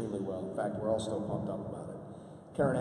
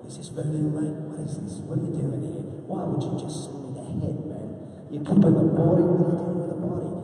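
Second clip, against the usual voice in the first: loud, higher mock-Australian rant; Australian accent; 6 semitones higher, 8 dB louder than usual. Actor questioning whoever mailed him a doll's head, Australian accent. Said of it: "like, this Australian accent".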